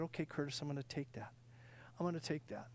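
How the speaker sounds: low, very soft assurance; gentle voice of God. Retold or imitated. God answers the preacher's surrender, quietly assuring. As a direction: answer softly and calmly, unhurried.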